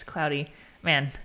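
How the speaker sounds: usual speaking voice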